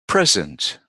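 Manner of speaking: teacher's careful PRESENT, stress up front; first-syllable stress. Shown as a demonstration. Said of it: "we stress the first syllable".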